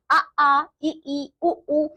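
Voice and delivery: आ, ई, उ, ऊ said alone, clearly held; vowel demo. Shown as a demonstration. Teacher asking class to say vowels, drawn out. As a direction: show the vowels said freely on their own. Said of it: "आप लोग जरा बोलिए तो".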